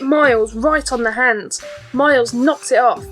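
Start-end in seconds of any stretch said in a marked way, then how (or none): none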